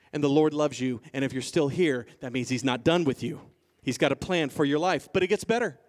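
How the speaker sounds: ordinary talk, nothing marked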